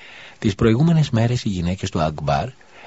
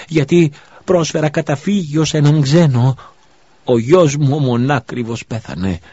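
higher and louder, a grieving mother's screaming lament; wailing curse; 7 semitones higher, 5 dB louder than usual. Acted. Widow curses the gods over her dead son, screaming. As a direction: hurl the curses with all her strength. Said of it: "άρχισε να φωνάζει με όλη της τη δύναμη".

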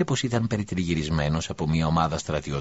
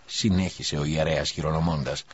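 ordinary delivery, nothing marked

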